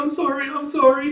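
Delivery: very high, loud squeal of apology; cried-out apology. Retold or imitated. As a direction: call sorry after a fleeing pet. Calling after fleeing cat.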